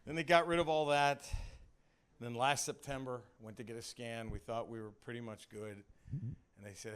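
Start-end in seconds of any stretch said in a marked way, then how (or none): none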